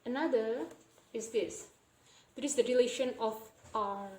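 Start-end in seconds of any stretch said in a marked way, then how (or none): none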